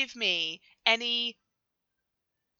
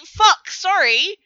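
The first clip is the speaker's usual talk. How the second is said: put-on Canadian accent; 8 semitones higher, 8 dB louder, slower than usual. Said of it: "say in a stronger Canadian accent".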